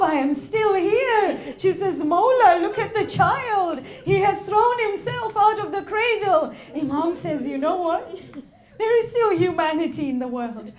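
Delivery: loud, fervent sermon delivery; impassioned preaching. Acted. Preacher voicing Imam and mother at Karbala, loud. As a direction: push every line with grief.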